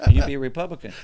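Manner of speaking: cracking up at his own joke; laughing. Retold or imitated. Friend finishing the party joke, laughing loudly.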